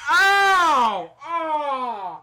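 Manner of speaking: sharp loud cries of pain; pained yelp. Real, not acted. Actor really struck on stage.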